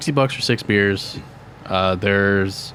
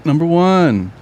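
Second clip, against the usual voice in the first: excited; 8 semitones higher, 5 dB louder, slower than usual. Real, not acted. Announcing his first beer pick.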